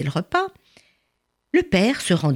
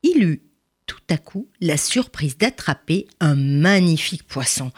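usual speaking voice

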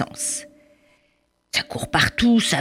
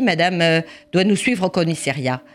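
usual speaking voice

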